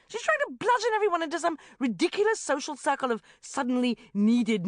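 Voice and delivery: loud, scornful rant; indignant rant. A narrator's reading. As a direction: rant against the hijack. Sharon ranting indignantly.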